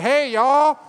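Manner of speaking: loud, high Southern holler; Gilroy Chow's Southern accent. Retold or imitated. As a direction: call it out in a big Southern accent. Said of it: "he had the most southern accent".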